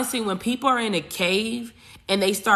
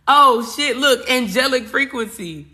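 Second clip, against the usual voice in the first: louder, higher excited exclaim; surprised; 6 semitones higher, 7 dB louder than usual. Real, not acted. Reading an angel message to viewers, startled delight. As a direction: burst out in delight.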